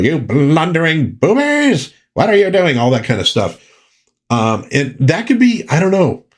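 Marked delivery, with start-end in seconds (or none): 0.0-2.8 cartoon Skeletor. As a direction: berate the minions like a goofy villain. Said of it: "is calling people".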